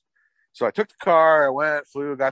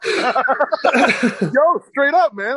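laughing, pitched high and loud; 11 semitones higher, 6 dB louder, much slower than usual. Real, not acted. Laughing with a friend, high and loud. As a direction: burst out laughing in agreement. Laughing agreement after funny memory.

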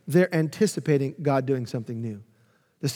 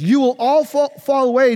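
raised warning; 8 semitones higher, 6 dB louder than usual. Acted. Reading Jesus' warning.

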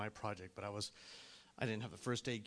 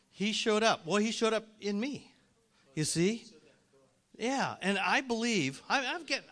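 raised, high preaching voice; impassioned preaching; 8 semitones higher, 9 dB louder than usual. Acted. Preacher to congregation on divine appointments, impassioned. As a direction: press the point with force.

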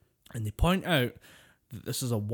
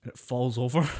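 ordinary talk, nothing marked

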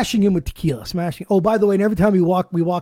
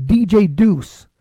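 announcer hype; 4 dB louder, much slower than usual. Acted. Hypes next week's show.

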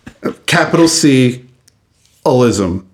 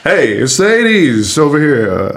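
announcer voice; 3 semitones higher than usual. Acted. Announcing the eighties.

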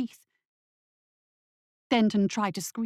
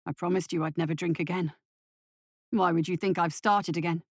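a hint of a pleading father, quick on the first line; earnest, hurt father trying to reassure his son; Denton, pleading father; 3 semitones lower, faster than usual.

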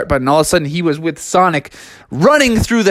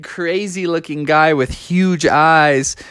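usual speaking voice

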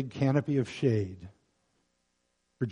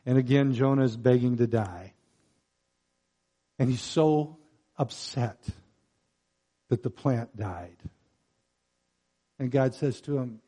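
usual speaking voice

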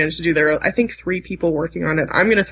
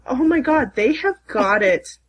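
excited; 5 semitones higher, slower than usual. Real, not acted. Excited about fan decoding.

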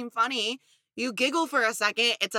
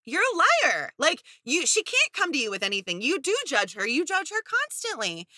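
indignant outburst; 6 semitones higher than usual. Retold or imitated. Viewers yelling back at a lying boyfriend on TV. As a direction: call him a liar, outraged. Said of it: "we're thinking".

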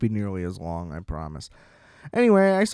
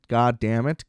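normal talking voice

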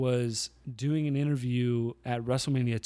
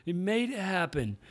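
mock fan shout; 6 semitones higher than usual. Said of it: "some fan in the audience goes".

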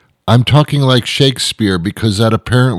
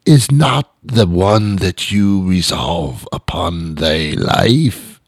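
put-on slow, laboured old-English wooing; Shakespearean lover; slower than usual. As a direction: declare love with drawn-out mock grandeur. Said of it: "express your love or passion".

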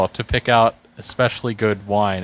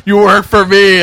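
loud, high taunting shout; 8 semitones higher, 10 dB louder than usual. Retold or imitated. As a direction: pop up and bellow it. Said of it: "He pops up and goes".

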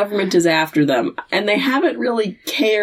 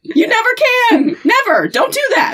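mock shouted warning; 14 semitones higher than usual. Podcaster yelling at Sam not to trust demons, frantic shout. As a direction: yell it like stopping a disaster, pitch climbing.

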